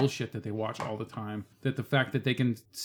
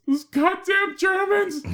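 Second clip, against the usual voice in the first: much higher, louder, slow sobbing wail; son's tearful outburst; 6 dB louder, slower than usual. Retold or imitated. Father imitating his crying son after the movie, high slow wail. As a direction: wail it like a heartbroken kid. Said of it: "he goes".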